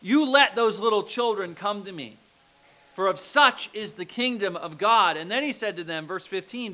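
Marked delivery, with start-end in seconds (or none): 0.0-5.2 louder, forceful command; raised voice, firm and insistent; loud, indignant Jesus. Acted. Indignant Jesus to his disciples, loud and emphatic. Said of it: "I imagine in a really loud voice".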